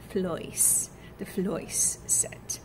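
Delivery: floice, fleece turned to an oi sound, slow and hushed; Cockney fleece vowel. Shown as a demonstration. Student drills her hardest Cockney vowel, whispered. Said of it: "my biggest struggle is the fleece set".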